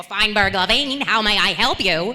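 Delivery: playing a fifties law-office secretary on a prank call. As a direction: answer like an old Hollywood office secretary. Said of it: "pretending to be, like, the fifties secretary".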